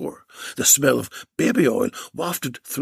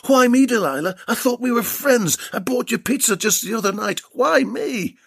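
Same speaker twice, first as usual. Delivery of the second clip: pleading; 8 semitones higher than usual. Acted. Bill pleads with his captor.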